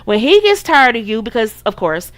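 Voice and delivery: ordinary talk, nothing marked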